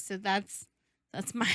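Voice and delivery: normal talking voice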